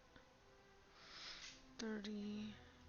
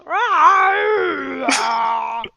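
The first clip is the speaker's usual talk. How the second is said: loud, higher beastly roar; dinosaur roar; 7 semitones higher, 15 dB louder than usual. Acted. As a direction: roar like a T-Rex. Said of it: "my dinosaur voice to sing".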